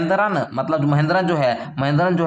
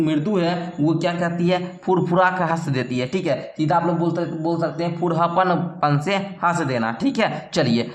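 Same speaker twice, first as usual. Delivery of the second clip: usual speaking voice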